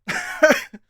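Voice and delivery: short high burst of laughter. Real, not acted. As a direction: laugh at yourself. Laughs at own joke.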